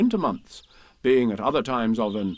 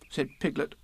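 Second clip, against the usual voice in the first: plain reading voice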